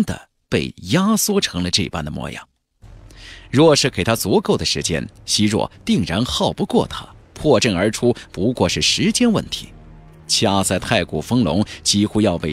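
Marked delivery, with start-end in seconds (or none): none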